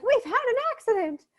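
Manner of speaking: put-on high-pitched gleeful child announcement; mock childish delight; ecstatic children. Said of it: "the children are just so ecstatic".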